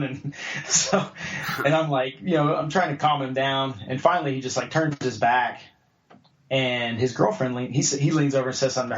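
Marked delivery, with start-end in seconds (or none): none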